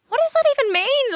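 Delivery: high, exasperated disbelief; incredulous question. Retold or imitated. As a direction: squeak it out, totally baffled. Baffled retort to office staff.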